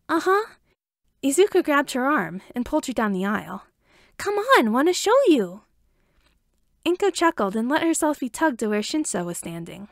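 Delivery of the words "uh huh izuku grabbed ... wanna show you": put-on high, bright little boy tugging; eager kid urging mom along; lightly voiced excited little boy; excited child. Excited child pulling mother.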